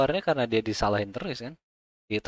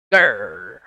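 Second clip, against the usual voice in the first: louder growled grr from the throat; tiger growl; 5 semitones lower, 5 dB louder than usual. Acted. Voicing the circus tiger for the chat, a growl. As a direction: snarl like a big cat.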